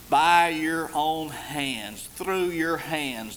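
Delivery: drawn out, very slow; slow emphasis. Shown as a demonstration. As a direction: lean on every word slowly. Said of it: "It's a very emphatic statement".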